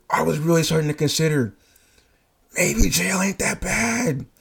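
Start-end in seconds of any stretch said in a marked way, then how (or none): 2.5-4.3 higher, slow daydreaming musing; slow tempted muse. Retold or imitated. [name] joking he might accept jail. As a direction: savor the thought slowly. Fantasizing about hitting her.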